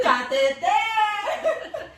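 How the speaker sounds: high, drawn-out mock yell, laughing; laughing, long-held call; comic shout. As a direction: holler it playfully, stretching the last syllable. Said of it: "virar e gritar".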